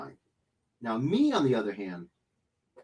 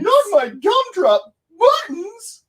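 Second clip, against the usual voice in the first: high squeaky cartoon cookie voice, slow; gingerbread man voice; 11 dB louder, slower than usual. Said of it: "Sounds like the gingerbread man from Shrek".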